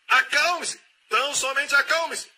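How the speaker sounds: preacher loses patience with the worrier, shouting; exasperated shout. Acted. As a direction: lose patience and shout calm down. Preacher escalates calm-down command.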